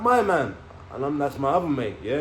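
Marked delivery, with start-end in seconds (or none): 0.0-0.6 high, loud re-enacted call; imitated shout back. Inmate shouting who was killed, re-enacted shout. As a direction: call the answer back across a distance.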